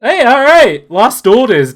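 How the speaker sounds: high, loud celebratory call; triumphant cheer. Real, not acted. Game master celebrating the player's winning roll, triumphant.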